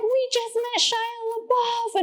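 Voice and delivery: high, drawn-out excited squeal; screaming friend. Retold or imitated. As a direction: imitate a friend shrieking down the phone. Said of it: "it's my friend screaming".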